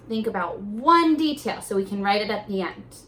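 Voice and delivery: ordinary talk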